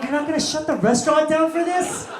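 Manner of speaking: loud, high-pitched disbelief; incredulous outburst. Retold or imitated. Comic protesting that the restaurant stayed open, incredulous outburst.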